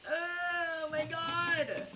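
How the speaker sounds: long, high drawn-out wail; exasperated wail. Acted. Streamer despairing over an awkward pub exchange, exasperated wail. Exasperated after accent story.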